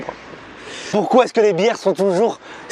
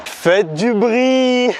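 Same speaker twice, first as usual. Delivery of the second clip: rapper hype call; 5 semitones higher, much slower than usual. Pretend. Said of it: "Que dit un rappeur".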